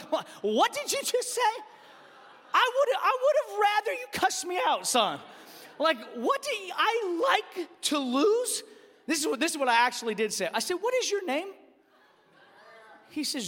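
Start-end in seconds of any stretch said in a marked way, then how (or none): 0.4-5.2 high-pitched, incredulous dad; hammed-up indignant outburst; mock outrage. Dad's outraged reaction to son. 6.2-8.6 high, drawn-out incredulous echo; hammed-up appalled dad; appalled disbelief. Dad repeats son's words, appalled. 10.8-11.5 high, indignant demand; mock-indignant parental quizzing; stern interrogation. Dad sternly interrogating son.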